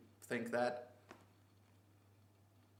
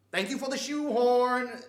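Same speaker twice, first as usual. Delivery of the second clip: much higher, very loud drawn-out mock gratitude; sarcastic shout; 11 semitones higher, 12 dB louder, slower than usual. Customer mockingly thanks the store for a useless substitute, sarcastic shout. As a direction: bellow the thanks slowly, stretching SHOEHORN.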